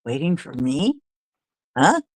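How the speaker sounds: lightly voiced credulous child; puzzled little kid. Little kid answering the radio, lightly voiced wonder. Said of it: "as a little kid".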